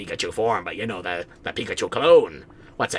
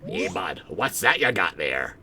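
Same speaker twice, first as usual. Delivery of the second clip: normal talking voice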